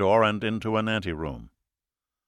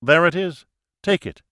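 raised, commanding pronouncement; 7 semitones higher, 5 dB louder than usual. Acted. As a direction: declare it like a ritual toast. Opening quote, raised voice.

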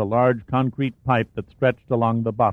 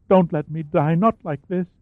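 gagging whimper; 6 semitones higher than usual. A narrator's reading. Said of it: "a gagging whimper now".

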